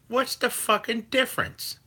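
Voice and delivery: high strained mock voice, way up in pitch; too hotty impression. Doing the chatter called too hotty in a shrill mock voice. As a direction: squeal it out like a cranky chatter. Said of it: "That's my too hotty impression".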